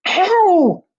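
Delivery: loud, high yelp of pain; screech. A narrator's reading. Liza gets her arm pinched, high loud screech. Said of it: "Liza screeched".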